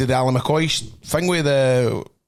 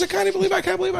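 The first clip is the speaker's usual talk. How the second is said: much higher, squeaky imitation of the young attendant; excited petrol guy; much faster than usual. Teller imitating the petrol station boy, high and eager. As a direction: play the starstruck kid at the till.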